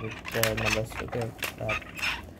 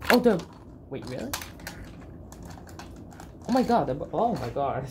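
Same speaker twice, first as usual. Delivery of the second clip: dawning joy; 2 semitones higher than usual. Real, not acted. Realizing the toys are special.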